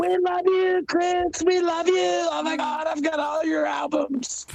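very high shrieking fan voice; screaming fangirl. Pretend. Fangirl gushing to Chris, very high shrieking voice.

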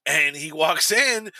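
ordinary talk, nothing marked

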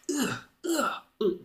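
short, high strained grunts; effortful grunts. Acted. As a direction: imitate guards swinging and missing. Swords swinging at air.

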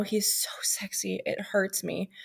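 breathy whispered confession; whispering. Real, not acted. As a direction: whisper it like a secret.

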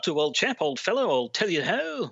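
hammed-up upper-class British accent; posh British accent. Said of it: "am I doing the accent the whole time?".

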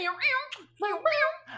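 high, loud meowing; cat meow. Pretend. Imitating cat.

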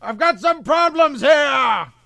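high, slow, desperate yelling; panicked movie shout. Retold or imitated. Podcaster shouting a horror movie line at his cohost, panicked yell. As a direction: scream it out like a terrified captain calling for help. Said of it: "Doctor Weird's great line".

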